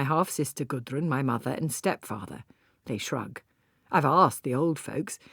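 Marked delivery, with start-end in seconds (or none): none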